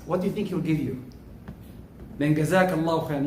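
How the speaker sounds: ordinary delivery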